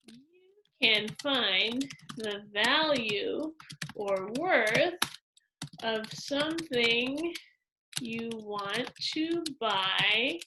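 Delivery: drawn-out, phrase by phrase, pausing between; slow dictation. Shown as a demonstration. Teacher writing out a model answer for pupils, slow dictation. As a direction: say it slowly as if writing it down.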